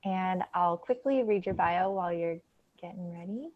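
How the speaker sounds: ordinary delivery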